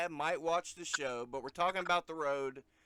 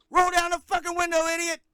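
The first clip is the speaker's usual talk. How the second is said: high, fast, furious yell; yelling cop; 14 semitones higher, 12 dB louder, faster than usual. Retold or imitated. Friend mimicking the cop yelling at him during a stop, high fast yell. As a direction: bark it like a cop losing his temper. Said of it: "He said".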